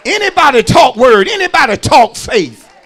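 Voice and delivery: loud, high, driving pulpit shout; full-force preaching. Real, not acted. Preacher declaring to the congregation how he talks, full-force preaching. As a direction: build punchy repeated phrases into a rousing cry.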